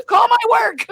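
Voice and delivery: high, loud comic holler; mock yelling. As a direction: holler it as if calling for backup. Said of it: "it's just me yelling to myself".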